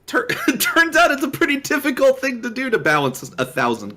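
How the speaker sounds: high-pitched, tickled delivery with a laugh; amused mock realization. Real, not acted. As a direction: break into laughter at the start, voice riding high. Joking about game balancing difficulty.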